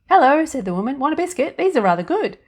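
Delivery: put-on high, loud, chirpy little old woman; fairy godmother voice. A narrator's reading. Tiny woman in the biscuit tin greeting Annie, high and chirpy. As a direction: pipe up brightly from inside the tin.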